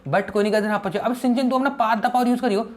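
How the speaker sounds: usual speaking voice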